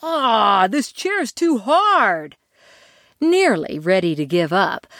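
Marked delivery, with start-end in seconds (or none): 0.0-2.4 slow, high complaint; complaining. Acted. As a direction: moan about it. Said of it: "only to complain".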